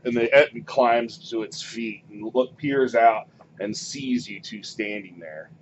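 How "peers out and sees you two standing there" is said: ordinary delivery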